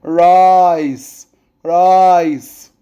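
"رايس" said slowly with a held I; long vowel. Shown as a demonstration. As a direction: draw out the I in each "رايس". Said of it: "بمد في الاي". (مد حرف الـ i في "رايس")

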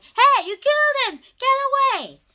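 high, loud yelled accusation; angry yell. Acted. The captain yells at the monster who killed Victor, loud angry yell. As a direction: scream it at the killer.

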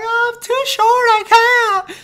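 squeaky, very high mock child wail; little kid voice. Kid who can't reach the brakes, squeaky panicked wail. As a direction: cry out helplessly in a tiny kid's voice.

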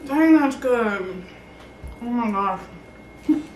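talking through a mouthful; chewing while talking, slow; mouth full. Real, not acted. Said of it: "trying not to talk in my mouth full".

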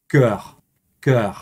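cœur said carefully in French; cœur with rounded French vowel, falling; French pronunciation. Shown as a demonstration. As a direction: model cœur as an isolated French word. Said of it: "Y en francés".

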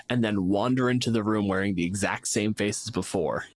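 usual speaking voice

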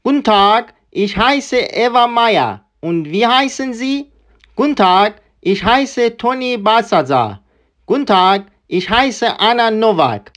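slow, clear German read-through; slow dialogue read. Shown as a demonstration. Teacher reads the full dialogue slowly to learners.

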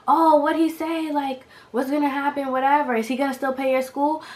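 high-pitched prying mother; put-on nosy mom voice; imitated mom.

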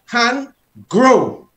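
high, loud order to the hand; commanding, with feeling. Shown as a demonstration. Teacher ordering his hand to grow, stern and forceful. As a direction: command it like a disobedient child. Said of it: "with feeling".

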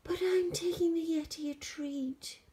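put-on high, polite boy; boy's voice. George explains his errand to Hetty, high boy voice.

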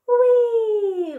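high, loud, long squeal of glee; gleeful whee. A narrator's reading. Little Monkey jumping onto the bed, gleeful. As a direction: leap off with a whee.

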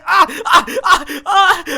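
loud, high yelps of pain; pained cries. Pretend. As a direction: cry out sharply after a bad landing. Falls after jumping, hurts leg. (acıyla bağırış)

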